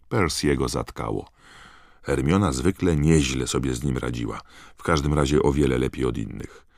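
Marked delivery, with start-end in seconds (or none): none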